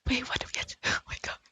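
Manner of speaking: hushed disbelief with a laugh; breathless whispered disbelief; stunned whisper. Real, not acted. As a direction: whisper it, overwhelmed and amused. Stunned whisper at quest requirement.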